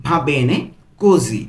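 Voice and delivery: "Va bene ... così", split by a pause; broken into pieces. Shown as a demonstration. Teacher putting the whole phrase together, in pieces. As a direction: hold back "così" after a gap. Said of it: "আমি কিন্তু ভেঙে ভেঙে বলছি".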